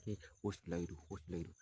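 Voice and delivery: frightened hush. Acted. Terrified guy asks quietly.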